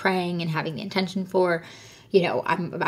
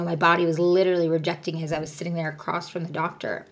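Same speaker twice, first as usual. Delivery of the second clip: usual speaking voice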